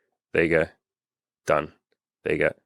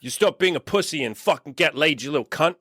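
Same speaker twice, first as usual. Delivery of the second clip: hammed-up aggressive rant, much higher; aggressive coach; 8 semitones higher than usual. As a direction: bark it like a drill sergeant. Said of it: "The aggressive Andy who was like".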